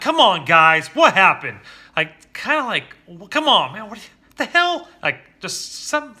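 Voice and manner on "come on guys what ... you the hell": put-on loud, exasperated yelling at the kids, much higher; frustrated dad losing his temper, shouting; old angry yell. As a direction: blow up at the kids over spilled water. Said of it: "some tone like that".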